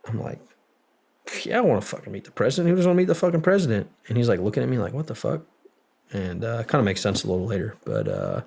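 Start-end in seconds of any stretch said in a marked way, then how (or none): 1.4-3.9 fast, higher, louder enthusiastic outburst; retold eagerness. Retelling his eager answer. 4.9-5.4 higher-pitched baffled question; retold bafflement. Retelling buddy's baffled look.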